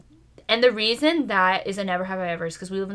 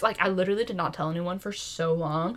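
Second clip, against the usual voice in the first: ordinary delivery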